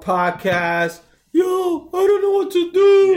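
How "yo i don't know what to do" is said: high-pitched whine, pitched way up; high whiny lazy listener. Pretend.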